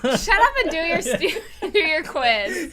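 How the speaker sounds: laughing retort. Real, not acted. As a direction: say it through laughter.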